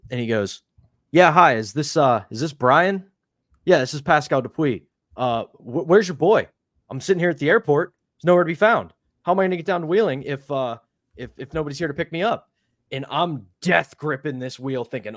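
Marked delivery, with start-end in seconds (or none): 1.1-12.5 higher-pitched, put-out phone complaint; mock stranded caller. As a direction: imitate a caller complaining about a missing driver. Retold prank complaint by phone.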